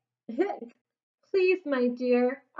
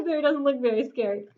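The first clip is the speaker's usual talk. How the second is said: usual speaking voice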